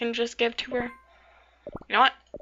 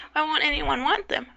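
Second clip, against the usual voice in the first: ordinary delivery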